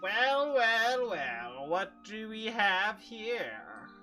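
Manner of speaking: villain drawl. Acted. GM voicing a villain greeting.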